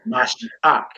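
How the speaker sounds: Mashiach in separate syllables, ending AK; correct pronunciation. Shown as a demonstration. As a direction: model Mashiach as ma-shee-AK. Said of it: "it's Mashiach".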